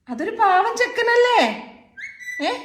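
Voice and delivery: sweet, slow, high pleading with a grumpy pet; high coaxing. Real, not acted. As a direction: coax her gently, slow and high. Coaxing the angry pet again.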